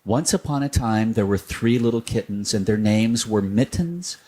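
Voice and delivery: usual speaking voice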